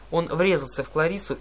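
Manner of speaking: plain reading voice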